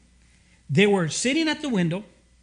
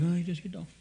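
sleepy listener; 7 dB quieter, faster than usual. Retold or imitated. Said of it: "And you're like".

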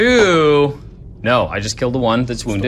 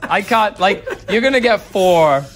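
high, laughing through the words; 6 semitones higher than usual. Real, not acted. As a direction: tease while laughing. Teasing opponent, laughing.

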